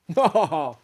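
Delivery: a short, amused laugh from the host; host laughs off his guest's surprise with a knowing chuckle. Acted. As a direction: chuckle at the young man's naivety.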